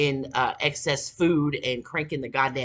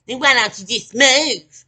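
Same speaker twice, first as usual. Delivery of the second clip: high, loud mock suggestion; mocking imitation; 8 semitones higher, 11 dB louder than usual. Mimicking those who advise moving away, high loud mocking voice. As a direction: mimic the clueless advice, pitched up and loud.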